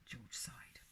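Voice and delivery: plain reading voice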